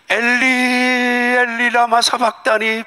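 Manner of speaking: slow, high, long-held lament; Jesus crying out from the cross; drawn-out cry of Jesus. Acted. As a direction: stretch each word as an anguished cry.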